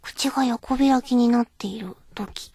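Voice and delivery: soft unvoiced whisper. Real, not acted. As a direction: breathe it out in a whisper. Whispered aside.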